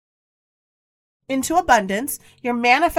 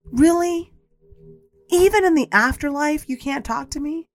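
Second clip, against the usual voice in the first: pitched higher, hurt and indignant; exasperated rant at dad; 6 semitones higher than usual. Retold or imitated. Retelling her angry plea to her late father, exasperated. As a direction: throw the question at him, fed up. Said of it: "I got so angry".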